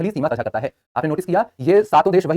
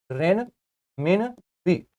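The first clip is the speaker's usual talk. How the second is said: Renminbi stretched very slowly, syllable by syllable; drawn-out drill; 5 dB quieter, much slower than usual. Shown as a demonstration. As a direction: draw out renminbi slowly for students to repeat. Teacher drilling currency name. (Renminbi को बहुत धीरे, खींचकर बोलना)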